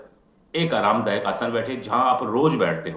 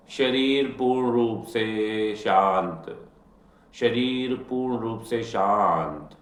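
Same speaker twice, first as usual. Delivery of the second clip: guided meditation. Acted. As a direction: ease them into stillness, unhurried. Leads a guided healing meditation.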